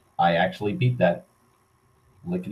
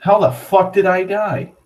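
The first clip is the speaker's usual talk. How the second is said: high, louder disbelieving cry; real shock at dying out of nowhere; stunned outrage; 10 semitones higher, 4 dB louder than usual. Real, not acted. Sudden death in the game.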